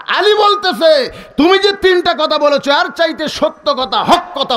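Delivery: high, ringing proclamation; emphatic preaching. Acted. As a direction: proclaim the verdict to the crowd. Preacher proclaims Ali's verdict.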